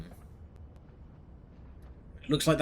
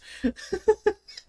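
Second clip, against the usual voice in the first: high laugh. Real, not acted. Laughs at own joke.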